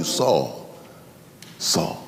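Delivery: soft, low, fading call of his name; tender call. Acted. Jesus tenderly calling Saul, low and soft. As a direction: let his name sink to almost nothing.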